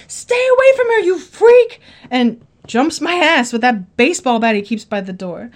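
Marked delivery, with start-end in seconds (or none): 0.1-1.8 much higher, louder panicked yell; retold scream. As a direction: shriek it as the scared boyfriend. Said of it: "Tony screamed".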